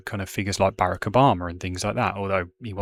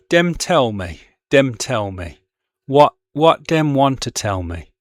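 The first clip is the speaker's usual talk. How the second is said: reads the poem aloud with Caribbean-dialect words, a little louder; poetry reading in dialect; 4 dB louder than usual. Shown as a demonstration. Teacher reading a poem aloud to the class, in dialect. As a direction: perform the verse with steady rhythmic emphasis. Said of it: "I will just read it in my, my Brummie accent as best I can".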